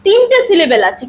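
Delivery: ordinary talk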